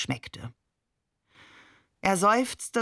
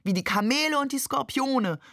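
called out; 7 semitones higher than usual. A narrator's reading. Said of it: "rief nun einer".

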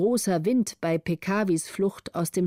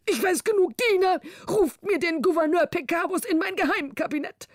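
high, sharp royal outburst; angry cry; 10 semitones higher than usual. A narrator's reading. King cutting off the letter, angry cry. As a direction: stop him mid-sentence. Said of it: "rief der König".

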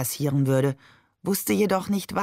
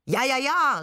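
higher mock-solemn assent; calling out; 7 semitones higher, 3 dB louder than usual. A narrator's reading. Shakir copies Babe's declaiming, calling out. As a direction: chime in with raised pitch. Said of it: "rief Shakir und tat es ihm gleich".

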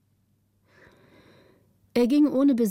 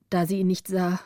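usual speaking voice

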